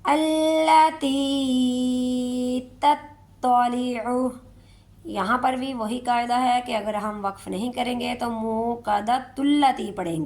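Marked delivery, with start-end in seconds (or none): 0.0-4.3 very slow stretched tilawat; Quran recitation. Shown as a demonstration. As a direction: draw it out melodically. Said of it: "recitation demo". (آہستہ تلاوت)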